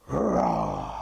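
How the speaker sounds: very low, growled lion roar; long, low mock roar; lion's roar. Shown as a demonstration. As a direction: drop deep and roar out the word like a lion. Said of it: "That's like a lion's roar".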